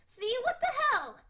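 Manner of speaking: high, loud shocked cry; shocked loud outburst. Acted. Stuffy troll yelping in shock at her language, loud. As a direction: blurt it out in shock.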